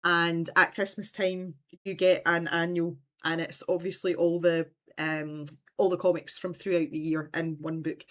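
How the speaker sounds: ordinary talk, nothing marked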